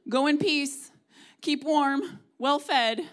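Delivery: sarcastic cheery dismissal; mock blessing. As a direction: toss it off like an empty blessing. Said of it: "epitome of thoughts and prayers".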